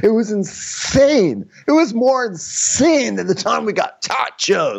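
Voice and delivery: high, drawn-out mock amazement; hammed-up Austin brag. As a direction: stretch the big words way up high. Continues the mimicry of Austin bragging.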